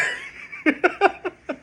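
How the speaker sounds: squeaky high laugh; high giggle. Real, not acted. Laughing at the size difference, high giggle. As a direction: giggle in delight.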